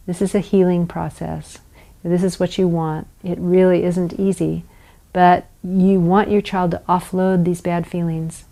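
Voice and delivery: ordinary talk, nothing marked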